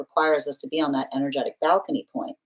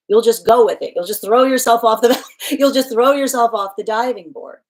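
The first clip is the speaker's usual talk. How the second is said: laughing, loud; 6 semitones higher, 7 dB louder than usual. Real, not acted. Laughing over a repeated punchline.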